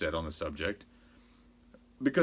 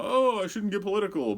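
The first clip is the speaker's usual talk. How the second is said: higher-pitched mocking aside; put-on "oh, I shouldn't" voice; mock reluctance; 11 semitones higher than usual. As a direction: imitate a host feigning reluctance, pitched up. Mimics host's feigned reluctance.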